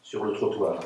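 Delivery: measured, articulated recitation of a poem; poetry reading. Acted. Poet reading her text to an audience, measured recitation. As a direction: recite it line by line, with pauses. Poets read their texts aloud.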